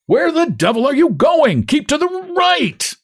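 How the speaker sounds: very high, strained street shout; high, outraged yell from the crowd. A narrator's reading. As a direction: holler a warning, drawing out the last words. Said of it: "hears shouts from the dark mass".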